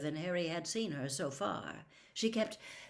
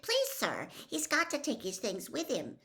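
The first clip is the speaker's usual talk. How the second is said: higher squeaking plea; squeaky; 10 semitones higher than usual. Acted. Boy begging the teacher to let Harry go, squeaky. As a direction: squeak it out timidly. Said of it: "squeaked Colin".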